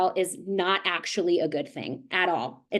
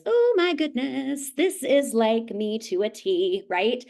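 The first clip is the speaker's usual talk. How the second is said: amused exclamation; 3 semitones higher, slower than usual. Real, not acted. Sees herself in the advice.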